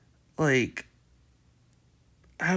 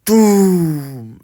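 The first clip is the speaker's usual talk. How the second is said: loud imitated boom, bigger than the last; mock game boom, louder; louder booming doom; 4 semitones higher, 11 dB louder than usual. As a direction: slam it out like a nearer thud. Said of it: "Then you hear".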